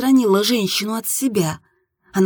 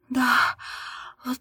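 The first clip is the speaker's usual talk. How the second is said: broken into breathy, higher pieces; breathless gasp; 4 semitones higher, slower than usual. A narrator's reading. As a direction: let each phrase escape on an exhale. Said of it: "только и смогла выдохнуть я".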